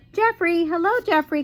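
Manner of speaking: high, loud call for a friend. A narrator's reading. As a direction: call his name across the yard. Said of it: "called Merton".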